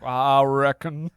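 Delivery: slow Appalachian drawl, "I reckon"; a light Appalachian accent. Retold or imitated. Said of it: "his thick Appalachian accent".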